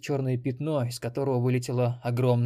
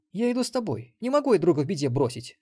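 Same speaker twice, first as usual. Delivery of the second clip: high female voice, firm declaration; Мелисса, declaring; 7 semitones higher, 5 dB louder than usual. A narrator's reading. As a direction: declare it firmly, pitched up. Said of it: "заявила Мелисса". (решительное заявление высоким женским голосом)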